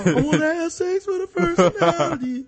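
high goofy impression; new Junior voice. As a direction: squeak it out like a dopey teen. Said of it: "he's all".

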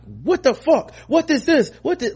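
high, loud indignant outburst; John Oliver. Retold or imitated. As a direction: imitate an exasperated TV host's rant. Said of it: "John Oliver's entire steez ... being like".

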